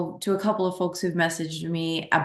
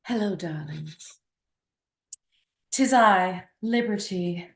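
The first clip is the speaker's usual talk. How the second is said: breathy vintage purr; slower than usual. Pretend. Grand entrance, breathy greeting.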